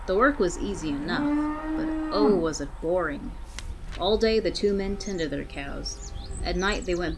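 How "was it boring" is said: ordinary delivery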